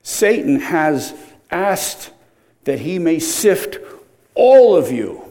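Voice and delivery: slow build to a loud, high "all of you"; slow, building emphasis; stress on ALL OF YOU. Shown as a demonstration. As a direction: hammer "all of you" at the end. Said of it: "It's "you" plural".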